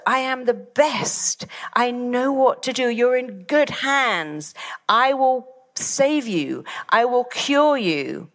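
slow, high, emphatic assurance; supremely confident. Shown as a demonstration. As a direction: promise a cure with total certainty, drawn out. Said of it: "Because the words".

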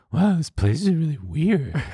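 high-pitched mock date voice; imitating weird date. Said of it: "this exactly how she sounds".

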